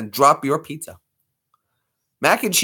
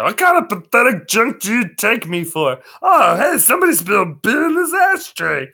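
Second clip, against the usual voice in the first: much higher, hollering cartoon barfly; Barney; 8 semitones higher than usual. Shown as a demonstration. Answering the bartender about the beer, high boisterous drunk. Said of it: "and I can do Barney".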